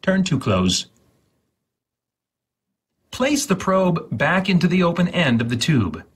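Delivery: ordinary talk, nothing marked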